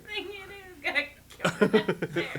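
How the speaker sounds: high mole voice breaking into laughter; imitated storybook mole. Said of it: "Exactly how he said it".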